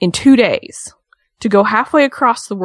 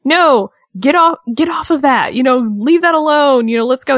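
higher, slowed mock telling-off; mock scolding husband; 6 semitones higher than usual. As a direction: playfully imitate a husband shooing her off. Miz scolding wife off wheelchair.

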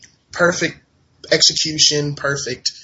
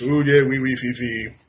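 put-on a light, jokey French accent on the oui; tossing off a quick mock French. Podcaster teasing a French wrestler in a mock French accent. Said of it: "Petois, of course, French".

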